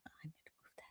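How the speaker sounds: fast unvoiced whisper aside; whispering to herself. Real, not acted. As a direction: whisper it quickly under your breath. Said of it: "muttering to herself".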